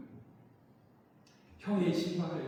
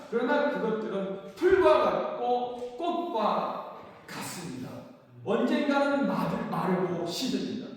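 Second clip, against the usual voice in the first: rising, louder preaching cadence, each line punched; 5 dB louder than usual. Acted. Pastor to congregation, fervent louder preaching.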